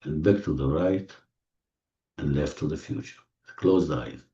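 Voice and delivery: soft, low, fading almost to nothing; soothing hush. Real, not acted. Guide leads closed eyes right and left to relax, soothing hush. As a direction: lull them with hushed, spaced-out words.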